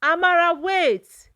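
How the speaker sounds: high, loud desperate call. A narrator's reading. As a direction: call after her daughter, desperate. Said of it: "her mother's voice behind her".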